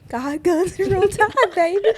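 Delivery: high-pitched Southern cheer; hyped Southern slogan. Pretend. Southern persona cheering her team motto, high Southern accent. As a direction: whoop the motto with a Southern twang.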